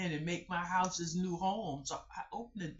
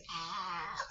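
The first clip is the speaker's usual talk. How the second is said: pained cry. Retold or imitated. Retelling her pain.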